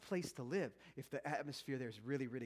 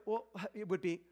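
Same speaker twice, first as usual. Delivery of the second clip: ordinary talk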